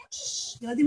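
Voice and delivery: ordinary delivery, nothing marked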